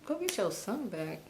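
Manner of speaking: hushed, puzzled repeat; quiet bewildered question; baffled echo. Retold or imitated. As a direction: echo it softly, as if not believing it. Her stunned echo of mother's words.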